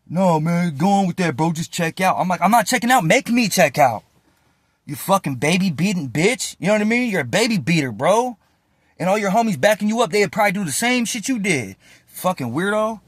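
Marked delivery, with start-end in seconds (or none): 0.1-2.2 higher imitated inmates urging him off; pressing dismissively. Acts out others pressing him. 2.4-13.0 heated retold insults, higher pitched; defiant taunting. Acts out defiant insults in quarrel.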